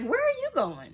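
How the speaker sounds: high, loud mock disbelief; incredulous question. Ladies stopping her on the stairs, incredulous.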